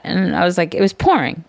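ordinary delivery

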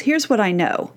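ordinary talk

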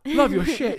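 fanboy rush, voice jumping high; starstruck fan greeting. Retold or imitated. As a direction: play it up like you've just spotted your idol. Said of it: "And I was like".

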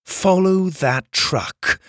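high, slow, deliberate order; commanding. A narrator's reading. Kai points a blade at the driver, commanding. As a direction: spell out the order with menace.

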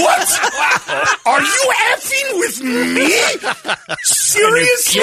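high, drawn-out incredulous protest; mock outrage. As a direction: act out indignant disbelief, pitched right up. Outraged customer retelling the exchange.

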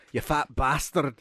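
slow mock-Scottish insult; Scottish accent. Imagining how Scottish people would react, slow Scottish accent. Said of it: "Scottish people would be like".

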